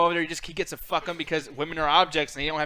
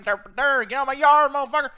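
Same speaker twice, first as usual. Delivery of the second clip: put-on slow, loud southern old man yell; southern accent; 7 semitones higher, 6 dB louder, slower than usual. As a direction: drawl it out like a cranky Southern grandpa. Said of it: "do the southern accent".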